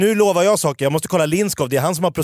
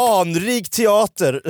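slow, lofty mock-solemn praise; drawn out like a pompous guide; mock reverent; 6 semitones higher, much slower than usual. Cohost mocking theatre's fame, slow mock-solemn praise.

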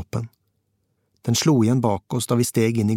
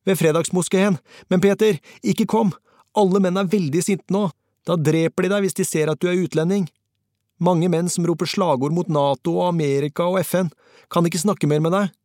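high, agitated warning over the phone; urgent, nearly incoherent; 8 semitones higher than usual. A narrator's reading. Fixer begs Peter not to come near the angry crowd, urgent. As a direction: warn him off in a high, breathless rush. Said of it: "Han snakket fort og nesten usammenhengende".

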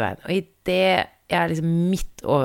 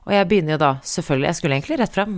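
ordinary delivery

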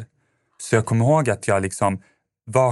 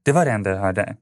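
ordinary delivery

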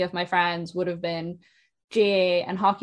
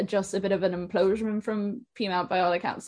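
ordinary delivery

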